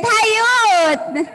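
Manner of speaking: loud, stretched-out rallying greeting; calling out. Real, not acted. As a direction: rouse a sluggish crowd with a long holler. Repeats the greeting to rouse them.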